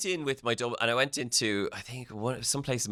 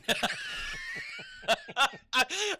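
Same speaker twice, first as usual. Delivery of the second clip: shrieking laugh. Real, not acted. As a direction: scream with laughter. Cohost cracks up at own joke.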